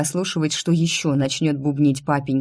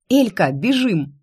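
urgent, eager call to run; 4 semitones higher than usual. Acted. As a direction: pull her along with your voice. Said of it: "рванула в гущу народа".

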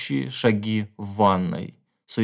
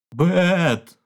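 high, loud shout of her name; the pinned man shouts for his wife; frightened shout; 8 semitones higher, 3 dB louder than usual. A narrator's reading. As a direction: cry out for help in panic. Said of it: "выкрикнул он".

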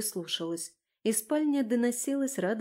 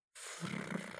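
soft voiceless rolling snort; low-volume fluttering animal breath; 16 dB quieter than usual. A narrator's reading. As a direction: snuffle like a big beast. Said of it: "повторил".

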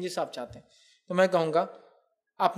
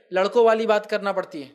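ordinary delivery

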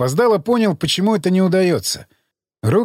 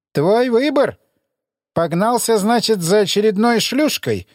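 sneering interrogator; 6 semitones higher than usual. Acted. Emissary sneers.

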